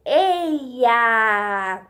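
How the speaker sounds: EIA stretched very slowly; drawn-out blend. Shown as a demonstration. Teacher joining three vowels for children, "eia" drawn out. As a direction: draw out each vowel of "eia". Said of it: "eu formo o".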